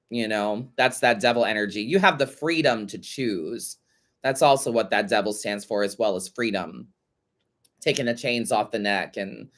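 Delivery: normal talking voice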